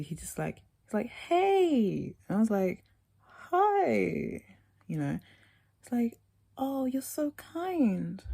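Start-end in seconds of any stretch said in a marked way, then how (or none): 1.1-2.2 a sudden high "Hey!"; high bright greeting. Retold or imitated. Imitating the boy greeting her with a high call. 3.5-4.4 a startled high "Hi!"; high squeaky hi. Retold or imitated. Answering the stranger with a high awkward hi. 6.6-8.2 drawn-out high "so kind"; slow high mock compliment. Imitating the stranger slowly praising her, high and drawn out.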